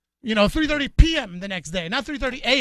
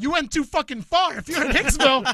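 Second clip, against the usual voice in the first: mock outrage; 6 semitones higher, 4 dB louder than usual. Retelling his shocked reply to her.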